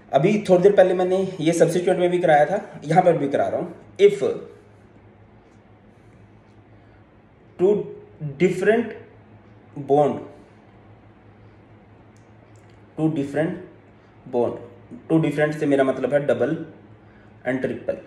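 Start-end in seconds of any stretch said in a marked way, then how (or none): 4.0-14.6 words parcelled out with long pauses; dictation. Real, not acted. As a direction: dictate bit by bit, leaving time to write. Dictating a rule for note-taking.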